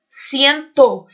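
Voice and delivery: "ciento" repeated loudly, the final "to" brought out; stress on TO. Shown as a demonstration. Teacher repeats the word for students, final "to" stressed in "ciento". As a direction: hit the ending "to" of "ciento" firmly. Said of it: "Eu acrescento o to aqui no finalzinho".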